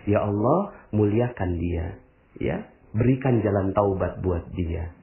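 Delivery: low, hushed demonstration of a prayer; gentle prayer. Shown as a demonstration. Speaker shows the audience how to pray for a stranger, low and hushed. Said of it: "Gimana cara mendoakannya?".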